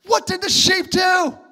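preacher challenging his listeners; rhetorical shout. Real, not acted. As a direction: challenge the congregation. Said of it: "preacher's rhetorical outburst".

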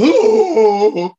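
hooting monster call, loud and high; beast howl. Retold or imitated. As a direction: call out like a big shaggy beast. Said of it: "so Ludo goes like this".

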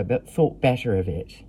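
ordinary delivery, nothing marked

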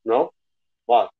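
talking while chewing bread; words round a bite of bread; mouthful of bread. Real, not acted. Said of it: "I've got a mouthful of bread".

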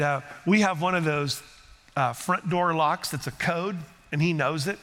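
ordinary talk, nothing marked

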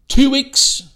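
high, loud mimicked accent; accented shouting. Woman answering the benefits officer, shouting in an accent. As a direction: shout it back in an imitated accent. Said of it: "with an accent screaming and shouting".

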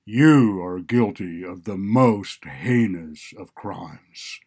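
a stern judge raising his voice; loud, stern rebuke. A narrator's reading. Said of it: "raising his voice".

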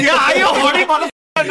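loud, high, hammed-up yell of the question; mock shout. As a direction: bellow it back like a joke.

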